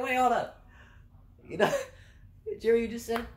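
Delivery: high and loud, wait hold up; excited call. Retold or imitated. Calling out to departing coworker.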